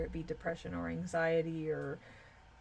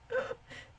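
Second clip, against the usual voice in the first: light laugh. Real, not acted. Laughs after singing.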